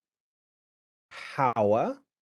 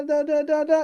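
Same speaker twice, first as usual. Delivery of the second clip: sung da da jumping up high; da da pushed way up in pitch; high pitch demo; 5 dB louder, faster than usual. Shown as a demonstration. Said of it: "it can go up".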